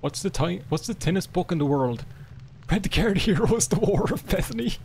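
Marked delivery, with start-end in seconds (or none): 2.7-4.8 higher, breaking into laughter; laughing punchline. Real, not acted. Gamer reading a joke punchline, laughing. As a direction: deliver it giggling.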